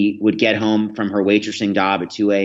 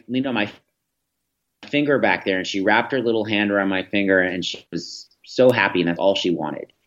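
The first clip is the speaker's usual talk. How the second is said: normal talking voice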